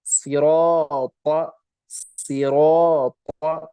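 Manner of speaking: सिराता slowly, with heavy full रा; heavy siraata. Shown as a demonstration. Teacher models the heavy alif after रा, slow. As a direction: stretch सिराता with a thick aa. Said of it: "अलिफ भी इसके साथ पुर हो जाएगा".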